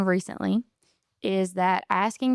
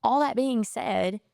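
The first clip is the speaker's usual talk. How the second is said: ordinary talk, nothing marked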